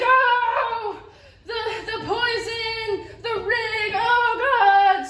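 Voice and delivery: high, drawn-out hammed-up groaning; dying swordsman. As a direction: wail melodramatically through a mock death. Acts out stabbed man dying.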